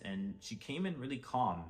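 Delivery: normal talking voice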